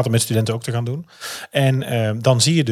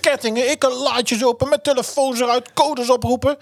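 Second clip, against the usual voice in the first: frantic excitement; 12 semitones higher than usual. Retold or imitated. Frantic escape-room action retold.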